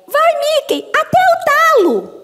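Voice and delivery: very high, squeaky cartoon voice; high-pitched cheer, last words stretched out; Minnie. Dubber reading her Minnie script line, in a high cartoon voice. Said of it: "veio no meu texto da Minnie".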